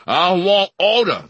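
slow loud commanding shout; bellowed command. A narrator's reading. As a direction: roar it while kicking. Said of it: "the giant shouted".

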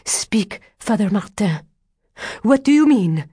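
high, demanding woman's cry; urgent cry. A narrator's reading. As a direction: demand an answer, pitch climbing. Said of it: "cried Adele".

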